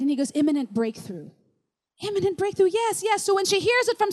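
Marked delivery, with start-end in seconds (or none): 0.3-1.3 voiced low and measured; God's pronouncement. God speaking to a praying teenager, low pronouncement. 2.1-3.2 lifted, keen agreement; eager confirmation. Retold or imitated. Teenager hearing her word confirmed, eager agreement.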